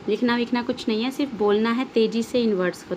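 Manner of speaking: ordinary talk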